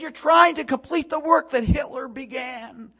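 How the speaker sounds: high, loud retort of an outraged woman; angry accusation. Retold or imitated. Angry Holocaust survivor accusing the man handing out tracts, yelling. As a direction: hurl the accusation at full pitch. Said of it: "and she was angry".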